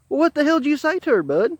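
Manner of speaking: quick, high-pitched, retold disbelief; incredulous demand. Storyteller asking the beaten-up coworker what he said, incredulous demand. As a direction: fire the question out, astonished. Incredulous question after poem backfired.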